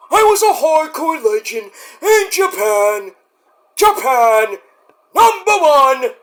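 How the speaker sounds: high, hoarse crying wail building to a shout; Terry Funk impression. Terry proclaiming his legend, crying then shouting. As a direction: wail it like a tearful hardcore legend. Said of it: "like Terry Funk would say".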